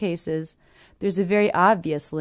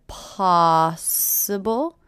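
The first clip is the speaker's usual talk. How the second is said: "possible" drawn out slowly, first syllable stressed; slow, exaggerated POS-sible for "possible"; much slower than usual. Shown as a demonstration. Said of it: "I notice the stress here".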